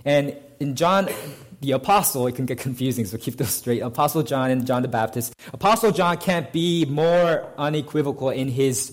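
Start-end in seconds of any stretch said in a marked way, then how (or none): none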